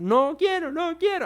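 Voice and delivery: very high whiny refusal; mock toddler wail, pitch climbing; spoiled child's tantrum. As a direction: whine it like a spoiled little kid. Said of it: "con su pataleta o su consentimiento".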